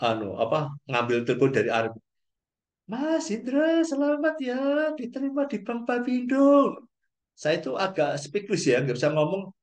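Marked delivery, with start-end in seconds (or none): 2.9-6.8 put-on much higher young woman's voice, cheerful congratulations; imitating Anita. Anita congratulating Hendra on the phone, high woman's voice. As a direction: lift well up into a girl's voice and cheer him.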